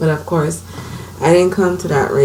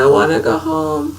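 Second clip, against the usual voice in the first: crying plea; 11 semitones higher than usual. Retold or imitated. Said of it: "I broke down crying".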